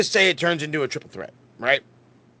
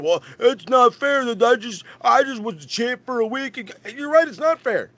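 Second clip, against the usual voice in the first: whiny crybaby; 8 semitones higher than usual. Retold or imitated. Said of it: "he's the whiny crybaby".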